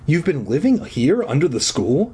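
plain reading voice

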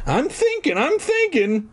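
high, sleepy sing-song; yawning reply. Acted. Lazy Fergie to Cooper, yawning high sing-song. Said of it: "Fergie answered with a yawn".

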